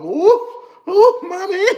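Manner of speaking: very high falsetto "ooh"; mock swooning. Streamer showing how he reacts to Latinas, mock falsetto swoon. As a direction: act out a smitten squeal. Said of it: "that's how I'm".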